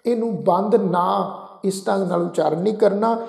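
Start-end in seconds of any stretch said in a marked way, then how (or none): none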